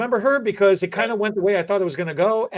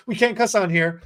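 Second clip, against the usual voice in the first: normal talking voice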